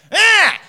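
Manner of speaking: loud, high gremlin screech; short mock scream of protest; imitated toddler shriek. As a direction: mimic a toddler's outraged squawk. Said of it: "she turns into Gremlin Eve".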